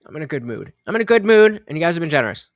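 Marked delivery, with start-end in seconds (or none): 0.1-0.7 low, soft mumble; quiet, low. Real, not acted. First take, low and soft. 0.9-1.6 bright louder take; louder, higher. Real, not acted.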